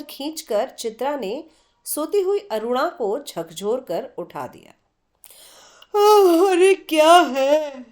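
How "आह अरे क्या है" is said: slow, groggy, annoyed waking voice; slightly irritated. Acted. Aruna woken roughly by Chitra, drowsy and irritated. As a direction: grumble as if just woken. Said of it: "तनिक खिजलाहट भरे स्वर में".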